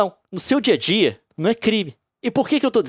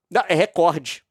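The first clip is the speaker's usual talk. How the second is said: ordinary talk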